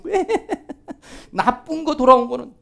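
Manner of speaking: usual speaking voice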